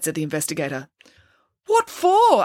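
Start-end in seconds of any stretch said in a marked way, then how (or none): 1.7-2.4 very high, outraged demand; high-pitched protest; indignant question. A narrator's reading. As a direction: demand to know, pitch shooting up. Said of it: "asked Bernie".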